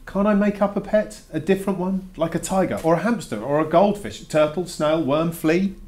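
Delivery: bargaining hopefully; eager pleading. Real, not acted. Boy eagerly bargaining with teacher.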